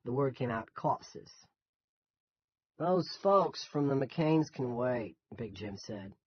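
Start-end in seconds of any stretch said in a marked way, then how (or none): 0.8-1.3 "corpses" said as "corpsiz" with the r dropped, Maine style; Yankee accent. A narrator's reading. Stuart says "corpses" in a Yankee accent. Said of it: "In his strong Yankee accent". 2.8-5.2 slow dismissive boss. Acted. Narrator voices Big Jim.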